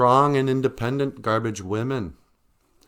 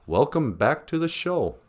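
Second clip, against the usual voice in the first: ordinary talk, nothing marked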